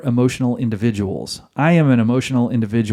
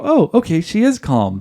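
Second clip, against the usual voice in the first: higher mock-relieved husband voice; gullible husband; 5 semitones higher than usual. Husband believing his wife has calmed down, naive relief. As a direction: accept it naively, pitch jumping up. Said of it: "when the husband's indulged in that and go".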